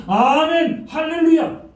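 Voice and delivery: high, bright amen hallelujah; mock cheering crowd. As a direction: cheer like an eager congregation.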